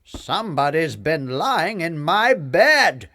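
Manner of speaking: big papa bear, loud and indignant. A narrator's reading. Papa Bear finds his bed used, loud bear voice. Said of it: "said Papa Bear".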